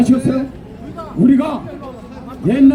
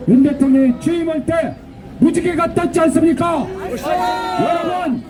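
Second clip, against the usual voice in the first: shrill echoing propaganda broadcast; 5 semitones higher than usual. Real, not acted. Rally chant leader.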